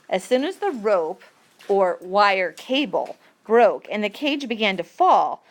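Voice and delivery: ordinary talk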